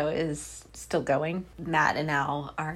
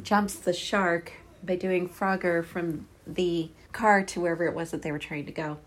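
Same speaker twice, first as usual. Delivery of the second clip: ordinary delivery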